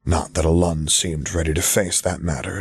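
ordinary delivery

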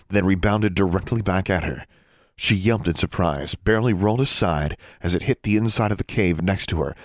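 plain reading voice

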